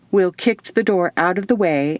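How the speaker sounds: ordinary delivery, nothing marked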